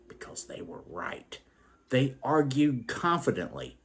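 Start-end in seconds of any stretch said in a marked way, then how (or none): 0.1-1.4 whispered aside. Acted. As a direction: drop to a whisper. Aside about arguing men.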